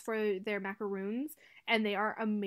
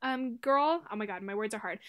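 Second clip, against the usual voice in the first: ordinary delivery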